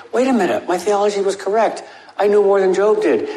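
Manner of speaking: high, indignant, self-righteous protest. Acted. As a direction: whine like smug know-it-alls defending themselves. Friends protest to God.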